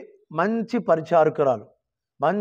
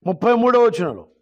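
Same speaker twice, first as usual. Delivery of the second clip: ordinary delivery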